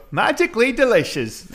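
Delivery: mock Irish ad line. Mocking the cereal ad's fake Irish way of saying it. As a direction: sing out "Magically delicious" like the cereal ad. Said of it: "They don't go".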